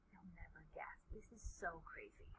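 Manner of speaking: very soft and low, almost whispered; secretive storyteller's murmur; hushed teasing aside. A narrator's reading. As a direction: tease the reveal in a confidential hush. Hushed aside before the reveal.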